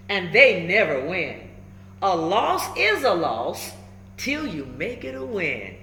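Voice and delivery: plain reading voice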